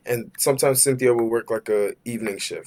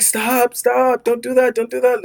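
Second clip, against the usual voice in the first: high pleading "stop, don't do that"; girlfriend pleading; 12 semitones higher, 5 dB louder than usual. Retold or imitated. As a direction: mimic a girlfriend begging him to stop. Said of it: "She was all like".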